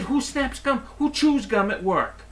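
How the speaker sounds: complaining to the manager about the gum-snapping cashier; indignant rant. Retold or imitated. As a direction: squeak it in disbelief. Customer ranting to manager.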